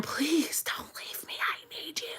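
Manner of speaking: high, slow whispered sob imitation; mock boo-hooing. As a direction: beg in a tiny hammed-up tearful whisper. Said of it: "boo-hooing. I'm grabbing him by the arm".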